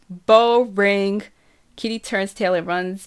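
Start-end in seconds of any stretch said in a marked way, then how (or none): 0.1-1.2 louder, drawn-out dismissal; dismissive kid. A narrator's reading. Little sister turning down helping them bake, loudly dismissive. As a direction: brush off the invitation loudly.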